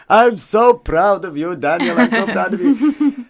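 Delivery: put-on high, slow, loud Polish Yiddish accent, doting; nan's Yiddish accent. Grandson imitates his nan's Polish Yiddish accent praising him. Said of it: "in her y-, hardcore Yiddish accent, Polish Yiddish accent".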